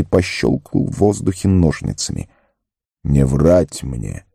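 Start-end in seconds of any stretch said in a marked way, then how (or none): 3.0-4.2 low, slow rebuke; stern wizard. A narrator's reading. Gandalf cuts off Sam's excuse, stern and low. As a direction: cut him off sternly, drawing it out low. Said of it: "сурово оборвал его маг".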